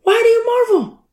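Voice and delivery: very high put-on angel voice; angel's voice. Angels question the disciples at the ascension, high-pitched angel voice. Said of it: "The angels are like".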